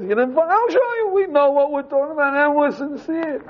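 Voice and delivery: high-pitched replay of the same plea. Shown as a demonstration. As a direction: push it way up high, mock eager. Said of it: "you say the whole thing is".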